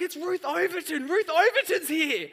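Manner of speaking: high, loud squeal of recognition; excited squeal. Retold or imitated. Wife squealing as she spots Ruth at the wedding. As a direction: squeal it out like she spotted an old friend. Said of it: "And she's like".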